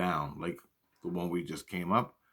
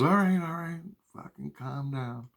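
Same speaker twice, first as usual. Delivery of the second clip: exasperated; 7 semitones higher than usual. Retold or imitated. Annoyed friend tells him to calm down.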